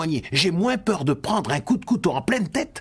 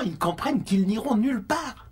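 ordinary talk, nothing marked

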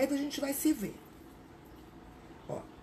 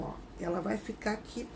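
ordinary delivery

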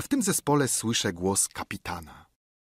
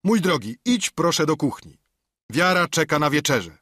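higher, louder firm request; captain dismissing; 5 semitones higher, 4 dB louder than usual. A narrator's reading. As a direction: send the cook off. (kapitan odprawia kucharza)

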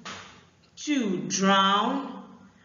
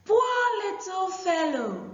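put-on high shouted girl's voice; 11 semitones higher than usual. Shown as a demonstration. Aku calls out in pity to the dog, high and shouted. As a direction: call out in pity, high like a girl. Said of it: "I take this part again".

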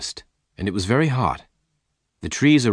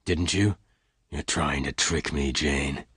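low, restrained accusation; hard voice; 5 semitones lower, 6 dB quieter than usual. A narrator's reading. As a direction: accuse coldly without raising the voice. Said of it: "said in a hard voice".

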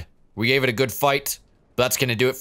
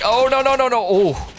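high, loud cries of no; panicked shout; 11 semitones higher, 4 dB louder than usual. Real, not acted. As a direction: panic as you go down. Getting killed.

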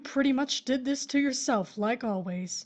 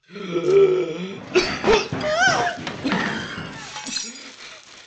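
long, loud, rising-falling gasp; shocked gasp; 7 semitones higher, 5 dB louder than usual. Acted. Widow seeing dead husband move, shocked gasp. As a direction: gasp in terror. Corpse comes alive.

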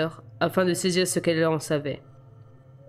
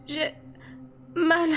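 put-on a much higher, pained girl's voice; murmure de douleur; 11 semitones higher than usual.

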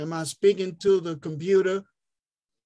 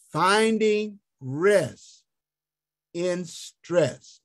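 slow emphatic; 2 semitones higher, much slower than usual. Acted. Preacher's slow emphatic theme.

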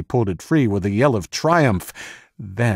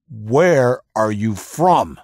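slow, louder, higher scream into his face; drawn-out interrogator scream; 4 semitones higher, 7 dB louder, much slower than usual. Acted. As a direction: bellow the question at the prisoner. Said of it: "he screamed into the moaning man's face".

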